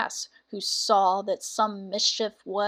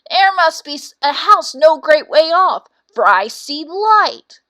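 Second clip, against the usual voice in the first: louder rooster calling down, slow; 5 semitones higher, 9 dB louder than usual. Acted. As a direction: call out to companions from the tree. Cock calls from treetop.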